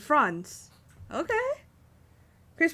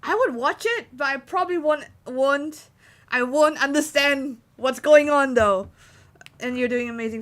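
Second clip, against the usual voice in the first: usual speaking voice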